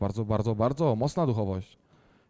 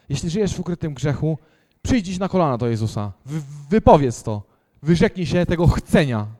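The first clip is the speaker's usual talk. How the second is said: loud, urgent altar call exhortation; preaching at full force; 6 dB louder than usual. Acted. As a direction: press the congregation to respond now. (kaznodziejskie, mocne wezwanie)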